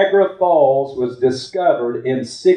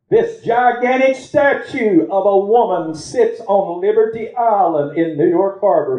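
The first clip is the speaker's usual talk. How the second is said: raised, pressing preacher voice; preaching; 6 semitones higher than usual. Acted. Preacher urging the congregation to hold on, raised voice. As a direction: drive each line at the congregation.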